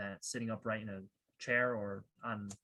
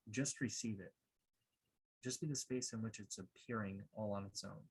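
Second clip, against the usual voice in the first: calm, measured meditation guide, softer than a welcome; guided meditation; 6 dB quieter than usual. Acted. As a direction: guide listeners gently inward, leaving long pauses.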